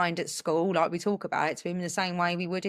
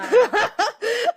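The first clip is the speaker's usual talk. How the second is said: delighted laugh; 6 dB louder than usual. Real, not acted. Laughing at son's cheek.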